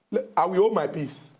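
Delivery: ordinary delivery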